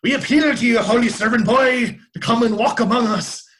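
high, loud mock-Irish cry; bad Irish accent. Shown as a demonstration. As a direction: call it out in a hammed-up Irish accent. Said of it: "I guess with an Irish accent".

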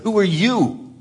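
high, sharp mock-indignant question from the rulers; indignant challenge. Rulers voiced challenging Peter and John, mock indignant. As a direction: toss it out like an offended priest.

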